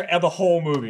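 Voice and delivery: usual speaking voice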